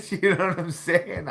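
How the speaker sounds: asking through a high burst of laughter; laughing. Real, not acted. To the listener, laughing at his own phone story. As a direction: let the laugh carry the question up.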